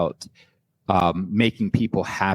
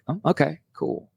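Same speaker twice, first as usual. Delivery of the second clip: indifferent reply; 7 semitones higher than usual. Retold or imitated. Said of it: "have this response like".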